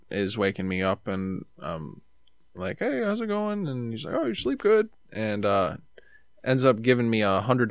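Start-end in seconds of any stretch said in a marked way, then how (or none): 2.8-3.7 high, light greeting voice; lightly voiced friendly guy; chirpy hello; friendly brother. Voicing the brother in story. 4.1-4.9 high, light greeting voice; lightly voiced friendly guy; chirpy hello; friendly brother. Voicing the brother in story.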